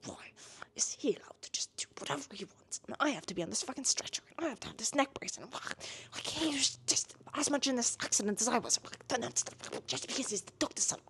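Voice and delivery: hushed, furious whisper-rant; acted whispered outrage; fuming under breath. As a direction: seethe quietly to yourself, words rushing out. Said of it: "just fucking fuming".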